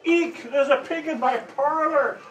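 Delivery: shriek. A narrator's reading. Missus Primrose finds a pig in her parlor, shrieking. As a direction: shriek it out in alarm. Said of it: "shrieked Missus Primrose".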